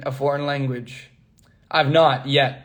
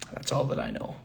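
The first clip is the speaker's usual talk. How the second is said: softer aside; 11 dB quieter than usual. Real, not acted. Admits limited German.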